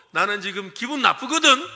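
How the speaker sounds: hammed-up annoyance; mock annoyed. Shown as a demonstration. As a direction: say it with a sulky face. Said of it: "그러고 기분 나쁜 표정을 지으라고요".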